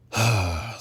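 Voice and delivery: loud, heavy huff of breath; fed-up exhale before answering; exasperated sigh. Acted. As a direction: let out a big frustrated sigh. Exasperated reaction to her reply.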